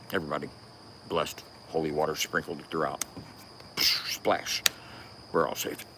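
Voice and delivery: a hushed mock benediction fading to breath; mock holy hush. Mock blessing after country rap joke.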